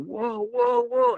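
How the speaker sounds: high-pitched mock-startled whoas; startled crowd reaction. Retelling everybody hearing limbs snap, high startled whoas. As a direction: imitate a group taken aback, voice jumping high.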